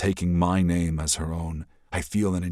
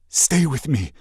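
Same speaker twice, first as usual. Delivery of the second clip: louder, urgent begging; pleading; 9 semitones higher, 5 dB louder than usual. Acted. Prince begs the princess to stay, urgent. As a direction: beg her not to go.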